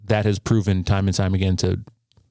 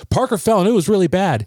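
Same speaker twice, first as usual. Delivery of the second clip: put-on higher, urgent child's report; imitated son reporting; 9 semitones higher, 3 dB louder than usual. Young son running in to tell dad about the fall, urgent report. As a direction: blurt out the bad news like a kid. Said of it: "my son came and goes".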